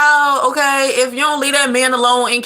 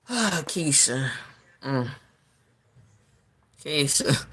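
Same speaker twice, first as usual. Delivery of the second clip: weary disapproving Keisha; 8 semitones lower, 11 dB quieter, much slower than usual. Real, not acted.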